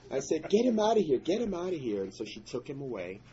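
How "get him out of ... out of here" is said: high, loud urgent order; urgent shout. Retold or imitated. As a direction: push them out of the room with your voice. Sending son away from blood.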